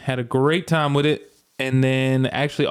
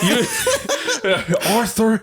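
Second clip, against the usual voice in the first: Dutch voice; 8 semitones higher than usual. Retold or imitated. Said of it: "how does he say it?".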